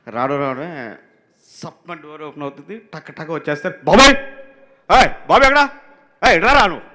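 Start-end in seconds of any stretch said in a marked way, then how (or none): 3.9-6.9 loud, high call across the set; director's shout. Retold or imitated. Director arrives fast and yells for his assistant, shouting. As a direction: imitate a boss yelling for someone. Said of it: "director గారు".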